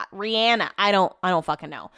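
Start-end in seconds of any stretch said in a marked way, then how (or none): 0.1-0.7 "Rihanna" with a short A, ree-AN-a; attempted other pronunciation. Shown as a demonstration. Host attempting the unfamiliar "Rihanna", middle vowel as in cat. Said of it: "I can't say [name]".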